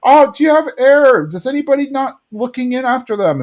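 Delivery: high-pitched imitation of a kid piping up; Bobby. Bobby at the party while other kids laugh, high-pitched imitation. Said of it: "Bobby is like".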